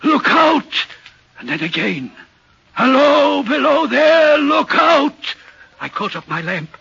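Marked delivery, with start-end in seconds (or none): 0.0-0.9 high, loud, urgent shout; shouted warning. Acted. Signalman repeating what the figure cried, urgent shouted warning. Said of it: "the voice seemed hoarse with shouting". 2.7-5.3 high, loud, sustained shout; shouted warning. Acted. Signalman repeating the figure's cry near the tunnel, loud shouted warning. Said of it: "the voice seemed hoarse with shouting".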